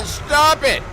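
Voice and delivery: loud, high frustrated yell; shouted stop it. Real, not acted. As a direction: shout it at the game. Stuck after crash, furious.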